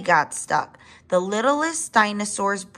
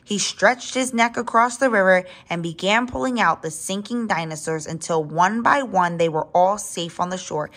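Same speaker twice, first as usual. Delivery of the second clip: ordinary delivery, nothing marked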